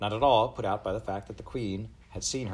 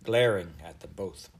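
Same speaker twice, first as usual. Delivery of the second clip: plain reading voice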